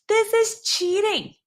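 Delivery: cheating jumps high and slow; a shocked, rising accusation; outraged. Shown as a demonstration. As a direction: pitch up in disbelief on this. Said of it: "This is how you actually would sound".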